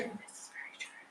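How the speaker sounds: breathy whisper, no voice. Pretend. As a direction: whisper it like a secret. Repeating line in different voices.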